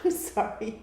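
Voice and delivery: breaking into loud laughter mid-sentence; laughing. Real, not acted. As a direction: laugh through the words, high and loud. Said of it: "I started laughing".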